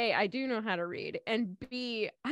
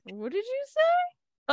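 surprised; slower than usual. Real, not acted. Shocked by the poop joke.

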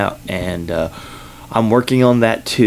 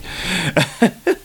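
light laugh at his own joke; 9 semitones higher than usual. Real, not acted. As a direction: chuckle after the punchline.

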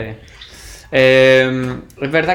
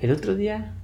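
real soft, higher-pitched murmur; speaking more quietly; 7 semitones higher, 6 dB quieter than usual. Real, not acted. Co-host starting an anecdote, speaking quietly. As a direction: drop the volume and lift the pitch. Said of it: "¿Por qué hablas más bajito".

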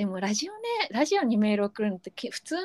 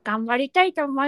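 ordinary talk

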